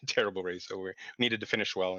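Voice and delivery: usual speaking voice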